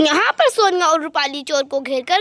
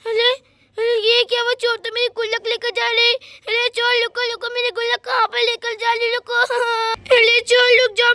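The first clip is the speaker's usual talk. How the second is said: put-on girl's voice, pleading and calling for help; alarmed little girl. Sonia calling after the thief stealing her piggy bank, alarmed. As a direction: call out like a distressed child.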